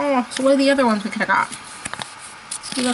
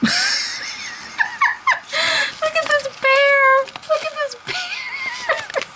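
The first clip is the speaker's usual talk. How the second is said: high squeal through laughter; high, slow laughing cry of delight; laughing shriek; slower than usual. Real, not acted. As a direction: shriek it while cracking up. Laughing at a funny bear figure.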